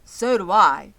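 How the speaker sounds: agreeing man. A narrator's reading. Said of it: "said another man".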